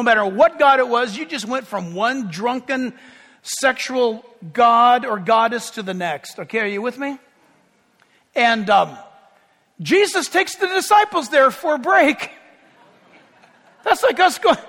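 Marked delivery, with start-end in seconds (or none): none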